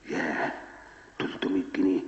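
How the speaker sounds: whispered gasp of envy; envious amazement. Retold or imitated. A neighbor hears the salary, whispered envious gasp. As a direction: hiss the sum in jealous wonder.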